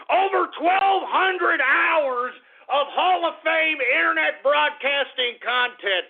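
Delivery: high, drawn-out hype announcement; bragging proclamation. Real, not acted. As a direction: trumpet the milestone slowly and high. Boasting of his milestone episode.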